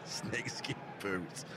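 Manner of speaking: slow, low, hushed longing; dreamy murmur. Real, not acted. Dreamy echo.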